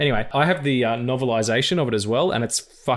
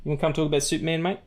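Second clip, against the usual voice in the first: pet voice; 4 semitones higher than usual. Real, not acted. Greeting his cat.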